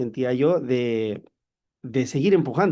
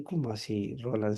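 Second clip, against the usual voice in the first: bewildered; 4 semitones lower, 10 dB quieter than usual. Retold or imitated. Bewildered reply to commander.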